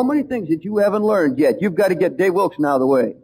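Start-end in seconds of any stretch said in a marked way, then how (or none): none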